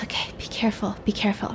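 soft, whispery caution; acted near-whisper; hushed warning. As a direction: warn them under your breath. Cautioning an accomplice in hiding.

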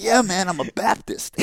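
high, casual buddy voice; lightly voiced buddy. Buddy admits he's Baptist, high and casual. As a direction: toss it off like a laid-back friend. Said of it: "he's like".

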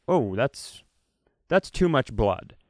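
ordinary talk, nothing marked

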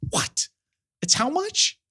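a high, squeaky jump on how much; mock outrage at the shop, voice leaping up; shocked at price. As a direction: act stunned by the price tag. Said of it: "I'm like".

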